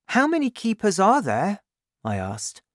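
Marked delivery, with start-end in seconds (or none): 0.1-1.6 put-on higher boy's voice, curious; eager boy asking his dad; young Danny. Danny asks, voiced high.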